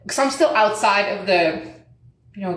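usual speaking voice

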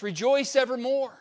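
ordinary delivery

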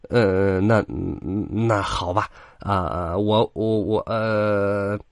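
flustered, halting wolf; stammering. A narrator's reading. Old wolf cornered by angry sheep mother, stammering. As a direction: stammer with drawn-out fillers, lost for words. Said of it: "老狼变得结巴起来".

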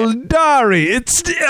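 high, hammed-up Italian lilt on Eldari; a pretend Italian showing off the new name; mock Italian. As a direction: sing out Eldari like a stage Italian. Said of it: "They were like".

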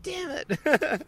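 high squeaky mock dismay; busted kid's mock groan; caught out. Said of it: "I was like".

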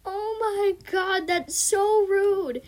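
high, slow drawn-out dismay; appalled. Real, not acted. Reader reacting to a rude joke, high drawn-out dismay. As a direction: stretch it high, scandalized on EJ's behalf.

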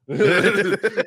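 high burst of laughter. Real, not acted. Laughing at his own story.

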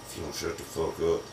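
a low, hushed warning from the wife, imitated; a quiet threat in church, lightly voiced. Wife shushing him at church, hushed imitated threat. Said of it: "Ashley was like".

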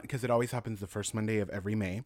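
usual speaking voice

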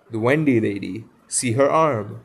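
amazed cry. A narrator's reading. Nibs points out the Wendy lady, crying out. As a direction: exclaim it as a storybook discovery. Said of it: "he cried".

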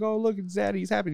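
high, put-on happy voice; lifted, sing-out mock cheer; mock cheerful family. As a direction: imitate delighted kids spotting their dad. Voicing the family looking up.

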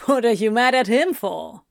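playful teasing question; a lightly teasing question. A narrator's reading. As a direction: tease him lightly. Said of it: "he teased".

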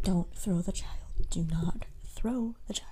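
very soft, held-back admonishment; hushed mock self-control; restrained warning. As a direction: say it under your breath, word by word. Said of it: "And then you're like".

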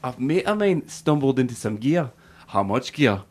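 put-on Scottish accent; Trainspotting Scottish accent. Host quotes Trainspotting to co-host, Scottish accent. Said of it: "I remember Trainspotting".

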